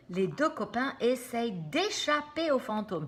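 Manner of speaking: ordinary delivery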